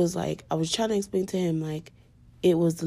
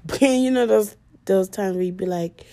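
ordinary talk, nothing marked

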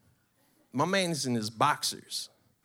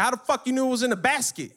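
angry; 10 semitones higher, 5 dB louder than usual. Retold or imitated. Acted angry interrogation of friend.

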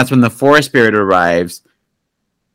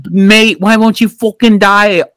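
mock British accent, higher pitched; hammed-up British villain, higher pitched; 10 semitones higher, slower than usual. Lady Eboshi shooting at the god, mock British accent. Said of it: "I don't know why she's British".

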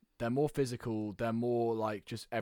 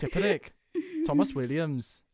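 higher, louder attempt at a Welsh accent; mock Welsh accent; 9 semitones higher, 6 dB louder than usual. Naming Welsh players for friends in a self-confessed bad Welsh accent. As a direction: try a Welsh accent you admit you can't do. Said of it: "I can't do a Welsh accent".

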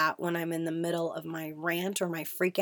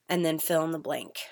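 usual speaking voice

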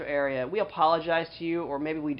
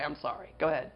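normal talking voice

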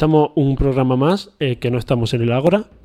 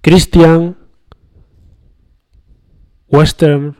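louder, slow show-opening announcer read; louder, slow announcer welcome over the music; radio host intro; 3 semitones higher, 5 dB louder than usual. Acted.

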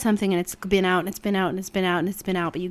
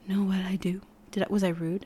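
soft, lowered, half-whispered question; hushed worry; 8 dB quieter than usual. Retold or imitated. She asks her friend if she was rude, hushed. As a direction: imitate anxious self-doubt in a hush.

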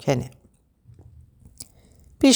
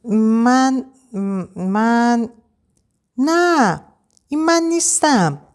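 higher-pitched, halting protest; flustered stammering; 6 semitones higher, much slower than usual. A narrator's reading. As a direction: falter between words, flustered and higher. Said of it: "به تته پته افتاد".

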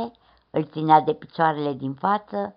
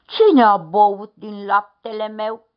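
loud growling papa bear; booming bear grumble; big bear growl; 2 semitones higher, 10 dB louder than usual. A narrator's reading. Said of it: "ursul cel mare a mormăit".

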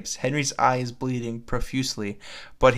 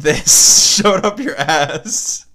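reading the line through loud laughter, drawn out slowly; can't get the words out for laughing; 6 semitones higher, 9 dB louder, much slower than usual. Real, not acted. Reading a silly fan fiction aloud, laughing through a loud slow line.

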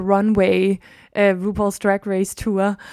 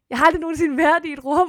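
ordinary delivery

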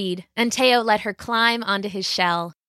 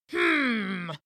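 pondering dragon king; 2 semitones higher, 5 dB quieter than usual. A narrator's reading. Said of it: "said the Dragon King".

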